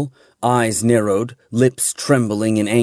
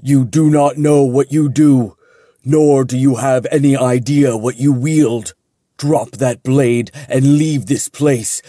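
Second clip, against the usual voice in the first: a big, louder angel voice with rising anger; thunderous with an edge of anger; 3 semitones higher, 3 dB louder than usual. A narrator's reading. Angel ordering Jin to drop the blade, thunderous anger. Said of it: "an edge of anger creeping into his thunderous words".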